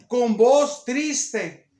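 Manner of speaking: very slow, stretched words; slow, weighty scripture reading; drawn-out reading. A narrator's reading. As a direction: linger solemnly on each word. Preacher reads verse with weight.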